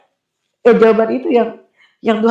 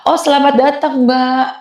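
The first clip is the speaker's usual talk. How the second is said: higher-pitched greeting in a put-on voice; retold welcome, pitched up; welcoming worship leader; 4 semitones higher than usual. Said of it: "ada W L yang tanya".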